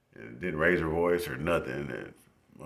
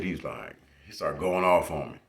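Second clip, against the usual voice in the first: ordinary delivery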